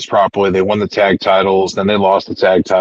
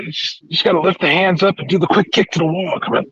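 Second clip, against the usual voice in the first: high-pitched wheedling, acted out; retold cajoling, pitched up; mock pleading; 8 semitones higher than usual. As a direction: coax a reluctant partner, imitating. Retelling MJF pleading for the move.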